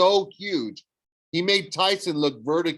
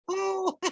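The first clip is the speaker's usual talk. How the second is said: very high weak giggle; nervous falsetto giggle; 4 dB quieter than usual. Acted.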